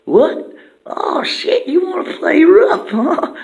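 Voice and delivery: high, loud squeaky dare; Turd challenging. Acted. Turd challenging the monkey to a fight, high and loud. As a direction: squeal the challenge like a cartoon brawler. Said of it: "He's like".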